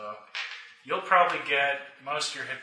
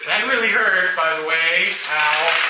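mock complaint; 5 semitones higher, 6 dB louder than usual. Real, not acted. Complaining about getting shot in face.

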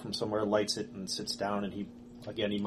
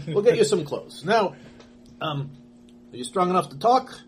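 raised, louder caretaker asking gently; a higher, louder take on the rescuer; the rescuer voice pitched up and pushed louder; kindly rescuer voice; 8 semitones higher, 5 dB louder than usual. Acted. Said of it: "restarts the line in voice".

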